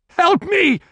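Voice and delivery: high, loud cry for help; desperate cry. A narrator's reading. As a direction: cry out as a trapped man. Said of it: "the trapped man cried".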